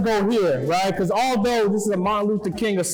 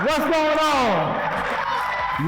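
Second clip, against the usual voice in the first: drawn-out crowd hype call; hype shout; 6 semitones higher, much slower than usual. Real, not acted. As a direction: stretch it out to the crowd. Hyping up school assembly crowd.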